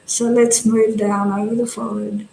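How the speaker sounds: calm body-scan voice, long silences between phrases; guided meditation. Real, not acted. Teacher guiding meditators to relax the face, calm and unhurried. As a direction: guide them gently, leaving space after each instruction.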